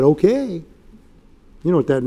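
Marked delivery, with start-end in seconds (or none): 0.0-0.6 slow falling "Okay." full of implication; loaded okay. Shown as a demonstration. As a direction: drop "Okay." down like a verdict. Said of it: "He said".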